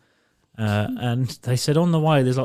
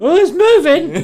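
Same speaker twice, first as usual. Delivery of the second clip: much higher, louder, slower frightened cry with a laugh; real fright; 8 dB louder, slower than usual. Real, not acted. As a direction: shriek it at the spider, laughing. Spotting a spider overhead.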